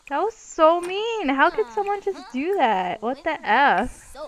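loud, high, drawn-out disbelief; appalled indignation. Real, not acted. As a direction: protest loudly, scandalized. Appalled at friend breaking dollhouse.

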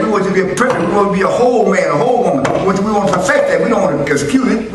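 high, loud, fast preaching; shouting the refrain from the pulpit; full-force preaching. Acted. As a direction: drive it home to the church.

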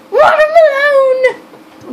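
drawn-out, high excited yell; excited shout. Real, not acted. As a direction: shout the answer out, slow. Surprised guess of flavor.

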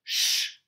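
loud unvoiced shush. Child in the story hushing the rat, firm shush. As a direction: hush someone firmly.

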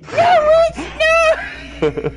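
very high, loud drawn-out wail; imitated screaming protest; mock outraged shout. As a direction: shriek the denial, stretched out slow. Acted outburst in retold clip.

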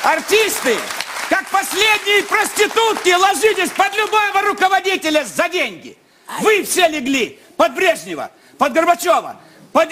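high, furious tirade; angry rant. Real, not acted.

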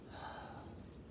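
soft, voiceless sigh; gentle acted sigh; little sigh. A narrator's reading. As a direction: let out a small, quiet breath. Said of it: "Dora gave a little sigh".

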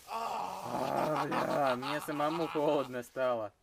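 ordinary talk

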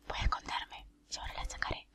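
very soft, secretive whisper; sneaky whisper. Real, not acted. Player hiding before her secret tactic, sneaky whisper. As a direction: whisper the plan while hiding.

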